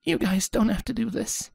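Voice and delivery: high, soft, worn-out voice; tearful, subdued. Acted. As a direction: quietly wave off the help. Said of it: "Sunset interjected hoarsely".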